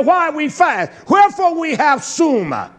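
high, loud protest to God; acted loud complaint. The people challenge Yah over fasting, acted loud complaint. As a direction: demand an answer in a preacher's shout. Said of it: "Now look how they talk to Yah".

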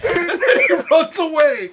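giggling through the words; laughing. Real, not acted. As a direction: laugh while you say it. Laughing at the video.

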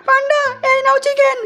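drawn-out high singsong call; high, stretched cartoon announcement. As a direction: call out slowly on one high note. Calling out, handing over chicken.

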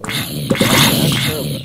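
low, slow mock disgust. Real, not acted. Gamer slowly scolding himself for misspelling. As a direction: groan at his own bad typing. Botching the word antibiotic.

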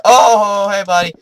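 sudden squeaky crack. Real, not acted. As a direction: voice cracking up high and loud. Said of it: "Oh, voice crack".

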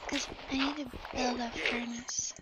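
whispering. Real, not acted. Whispered aside.

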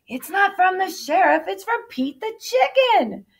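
high, loud storybook voice; excited turkey character. A narrator's reading. Cassie corrects the panicking turkeys.

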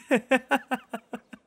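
bright, high teasing giggle; a high giggle after a playful jab; playful laugh. Acted. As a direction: laugh at your own tease. Teasing about other VAs.

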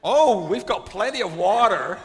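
high, loud mock American accent; imitated American stewardess. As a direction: gush it like a hammed-up American stewardess. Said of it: "stewardess says".

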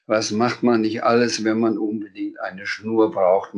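plain reading voice

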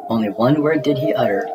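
ordinary delivery, nothing marked

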